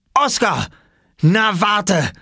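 high, loud threat; angry shout. Acted. Boy whose towel fell in the lake, angry shout. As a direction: yell the name, then threaten. Owner sees towel fall in water.

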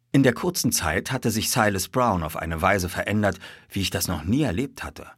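ordinary delivery

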